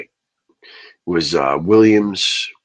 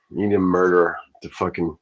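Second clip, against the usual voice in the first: normal talking voice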